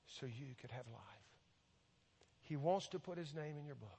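soft, earnest pleading with long pauses; hushed altar appeal. Real, not acted. Preacher inviting listeners to accept Jesus, hushed appeal. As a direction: let each line drop quietly, giving it room to land.

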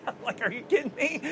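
high, incredulous laughing question; laughing disbelief. Real, not acted. Laughing disbelief at the failed stunt.

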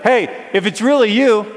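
high, loud holler; calling out. Pretend. Disciple in a boat to figure on the water, calling out. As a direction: shout it from a boat. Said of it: "You should be in a boat and be like".

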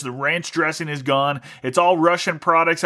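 ordinary delivery

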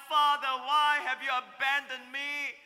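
loud anguished plea; crying out. Acted. Preacher voicing Jesus on the cross, anguished cry. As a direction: call out in pain. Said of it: "Jesus crying out".

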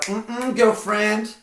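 put-on high sassy "mm-mm, girlfriend"; sassy attitude. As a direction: throw some sass at it. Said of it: "that is an attitude".

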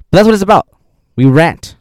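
loud, high-pitched declaration; emphatic outburst. Real, not acted. Owning up to ranting.

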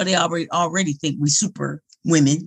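ordinary delivery

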